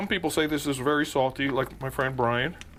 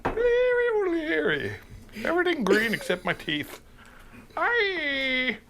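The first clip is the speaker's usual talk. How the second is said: high, slow mock Irish brogue; playful fake Irish accent; 12 semitones higher, slower than usual. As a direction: ham up a leprechaun's Irish lilt. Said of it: "whatever this attempt at an accent".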